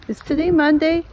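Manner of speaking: high, surprised question; suddenly unsure what day it is. Real, not acted.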